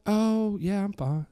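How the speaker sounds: high, airy mock-casual voice; breezy imitation. Daughter dismisses the attack to her father, breezy. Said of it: "She's like".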